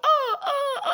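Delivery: very high, loud flustered oh. Retold or imitated. Witness cornered by the attorney, squeaking flustered oh. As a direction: caught off guard and squeaking.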